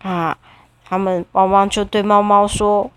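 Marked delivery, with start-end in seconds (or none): none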